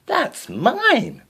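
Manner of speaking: high shrill-voiced stepsister yell; snatch it back, yelling. A narrator's reading. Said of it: "she yelled".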